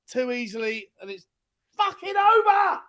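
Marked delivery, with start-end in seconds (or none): 1.8-2.8 high, exasperated burst; frustrated outburst. Real, not acted. Fan after losing late, frustrated outburst.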